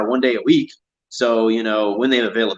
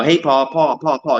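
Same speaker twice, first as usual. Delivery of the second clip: put-on child calling; 4 semitones higher, slower than usual. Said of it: "He always calls me Paul".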